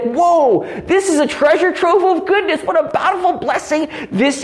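high-pitched excited wonder; gushing, awestruck exclamation; amazed delight. Acted. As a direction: marvel at a treasure you just found. Acting out an amazed catechism student.

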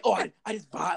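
high-pitched put-on stoner voice. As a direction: imitate a blissed-out weed smoker. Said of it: "people who smoke weed".

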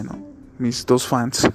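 ordinary talk, nothing marked

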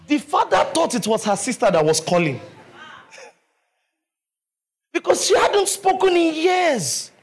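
loud, fervent sermon delivery; full-force preaching. Acted.

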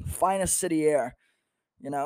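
ordinary delivery

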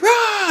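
a sudden high-pitched mock scream. Man on fire on a Ferris wheel, high yelp. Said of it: "Peter's just like".

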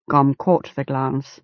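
plain reading voice

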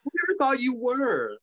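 high-pitched mock British voice; British accent. Imagining someone out of the hen house, high British accent. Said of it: "doing a British accent".

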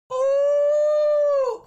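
a high, loud excited oh. Real, not acted. Podcaster cheering for Trump in high excitement.

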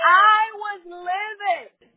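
high, loud and drawn out; heated storyteller exclamation; emphatic outrage. Retold or imitated. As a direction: belt it out slowly for dramatic effect. Recalling her fury at manager.

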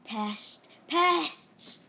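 indignant yell at troublemakers; calling out. A narrator's reading. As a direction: call it out high and loud, shaking a fist. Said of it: "shook his fists ... and called out".

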